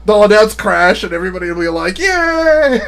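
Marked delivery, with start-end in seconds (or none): 2.0-2.8 high, drawn-out mock yay; imitated fan cheering. Streamer imitating everybody at a Crash announcement, high cheering. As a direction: squeal it like delighted fans.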